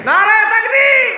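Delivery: loud call to the crowd; rallying shout. Real, not acted. Preacher calls crowd slogan.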